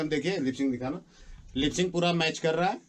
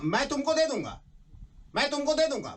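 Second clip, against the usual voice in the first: much higher, punchy, दे stressed; filmy dialogue demo; 10 semitones higher than usual. Shown as a demonstration. Director demonstrating line to actor, punchy. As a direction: hit it like a film line, twice. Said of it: "चलो एकदम".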